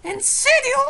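very high loud cry; shrieking disbelief. Real, not acted. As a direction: shriek it in shock. Shrill disbelief. (grito incrédulo)

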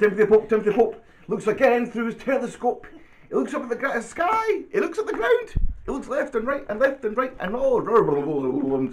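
high, excited storybook narration; reading to children. Acted. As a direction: build towards the big shout. Reads picture book aloud.

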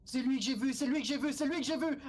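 high frantic panic, voice shooting up; panicked yelling. Real, not acted. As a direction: shout it in terror as the monster appears. Horror game, spotting a monster.

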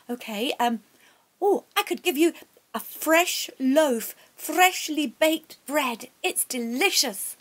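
anxious mother offering anything she has; eager Nan. Acted.

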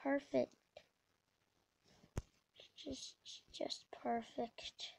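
whispered, deflated muttering; exasperated whisper. Real, not acted. Gamer fed up after mishaps, exasperated whisper. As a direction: drop to a slow, defeated whisper.

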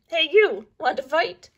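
loud high picking a fight; bully bug challenge; grouchy ladybug. A narrator's reading. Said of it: "the grouchy ladybug said".

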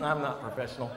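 mumbled mock-guilty confession; sheepish confession. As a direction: admit it small and embarrassed. Sheepish confession at truck stop.

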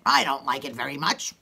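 low, emphatic grumble; like you mean it. Shown as a demonstration. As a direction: say it low, really meaning it. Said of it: "say it like you mean it".

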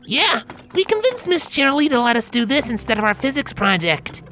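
very high filly voice, proud; proud Scootaloo. A narrator's reading. Scootaloo telling how they got out of physics, high and proud. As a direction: boast about the project. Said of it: "Scootaloo declared proudly".

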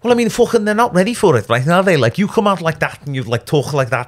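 high-pitched northern English accent veering into Scouse; put-on British accent. Shown as a demonstration. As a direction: show off a thick Scouse-like accent. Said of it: "Do you think you could have tricked them?".

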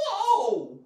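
big loud excited whoa. Shown as a demonstration. Teacher showing the drama an exclamation point adds. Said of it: "It adds that".

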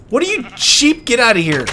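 high, odd voice at the sheep; mock squeaky question. Real, not acted. Player shooing a sheep from his base, high silly voice.

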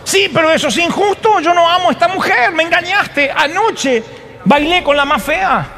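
high, wronged groom shouting; indignant protest. Acted. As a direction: plead and rage at the unfairness. Jacob protests the deception.

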